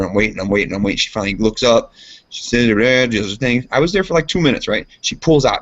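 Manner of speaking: normal talking voice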